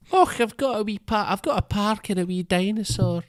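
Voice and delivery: put-on high, lilting Scottish old man; hammed-up Scottish accent, pitched high; wee Scottish man. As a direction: marvel at it like a little Scottish grandad. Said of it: "line of his I remember".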